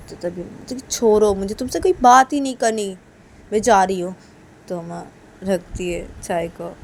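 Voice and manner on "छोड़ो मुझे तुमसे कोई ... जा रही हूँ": louder, dismissive parting words; acted offended walk-out; huffy refusal. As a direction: break off the argument in a huff. Uma breaks off and leaves.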